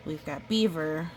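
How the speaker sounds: ordinary delivery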